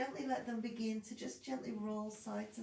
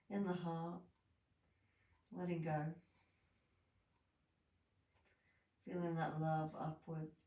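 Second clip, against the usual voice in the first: guided meditation; 4 semitones lower than usual. Acted. Guided relaxation cues.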